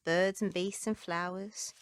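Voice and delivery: ordinary delivery, nothing marked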